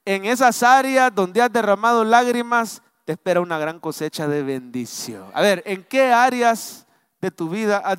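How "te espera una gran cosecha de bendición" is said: usual speaking voice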